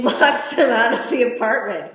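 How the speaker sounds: ordinary delivery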